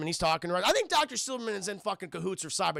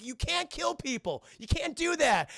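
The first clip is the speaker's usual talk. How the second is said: high, slow, appalled protest; retold exasperation; 9 semitones higher than usual. John lectures Arnold on killing, high mock-appalled voice. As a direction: act out a kid pleading with a machine.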